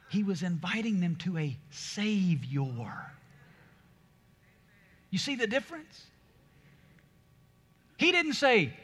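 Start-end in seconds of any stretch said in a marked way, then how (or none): none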